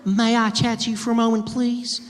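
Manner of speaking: high, sweet flirty Southern belle. Retold or imitated. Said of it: "she was like".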